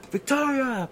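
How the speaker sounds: high call across the rink; calling his daughter's name across the ice. Retold or imitated. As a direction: call her name over the music.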